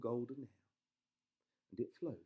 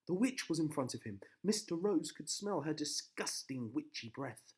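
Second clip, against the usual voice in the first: ordinary delivery, nothing marked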